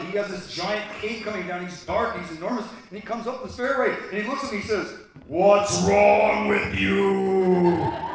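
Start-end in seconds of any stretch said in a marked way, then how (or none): 5.3-8.1 very slow, loud, drawn-out moan; ghostly figure. Retold or imitated. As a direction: stretch every word like a looming ghost. Dark figure speaks like a ghost.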